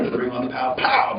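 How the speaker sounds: normal talking voice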